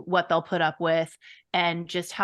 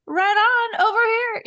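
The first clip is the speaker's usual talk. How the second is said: calling out; 13 semitones higher, 5 dB louder, faster than usual. Retold or imitated. Calling for the beach ball.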